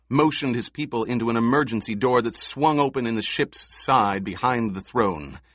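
ordinary delivery, nothing marked

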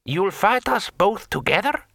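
high-pitched rising disbelief; voiced character, pitched well up; incredulous. A narrator's reading. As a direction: question the dare as if absurd. Brother incredulous at the challenge.

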